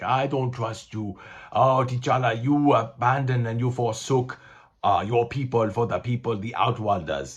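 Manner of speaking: put-on Wakandan accent, accusing; Wakandan accent, W'Kabi. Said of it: "that was my accent I used as Wakanda".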